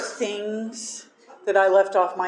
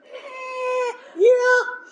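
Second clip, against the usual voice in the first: high squeaky eh, then a louder sheepish yeah; sheepish squeak; 4 dB louder than usual. Retold or imitated. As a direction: caught out, squeak the admission. Sheepish admission to sponsor.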